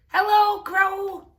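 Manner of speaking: high, loud hello; shouting. A narrator's reading. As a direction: call up to the crow, loud and high. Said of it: "shouted Toad".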